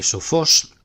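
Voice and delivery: "sofós" with FÓS hit firmly; stress on final syllable. Shown as a demonstration. Teacher comparing accents with the masculine, "sofós" stressed on FÓS. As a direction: punch the last syllable of "sofós". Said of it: "acento en la misma sílaba".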